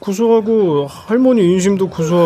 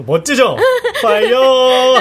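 excited; 6 semitones higher, 4 dB louder, slower than usual. Acted. Showing off fiery outfit.

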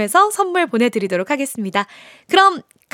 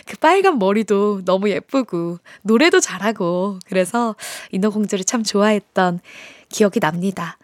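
usual speaking voice

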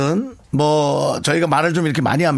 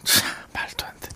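whisper; 9 dB quieter than usual. Real, not acted. Aside in disbelief.

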